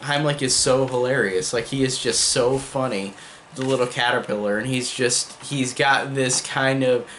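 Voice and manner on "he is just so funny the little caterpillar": ordinary talk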